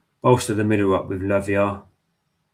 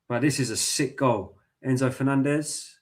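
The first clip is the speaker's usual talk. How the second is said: usual speaking voice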